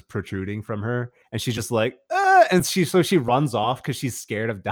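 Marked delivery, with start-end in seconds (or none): 2.1-2.4 sharp high yelp of pain; a sudden shocked scream; startled cry. Retold or imitated. Stabbed zombie lady cries out.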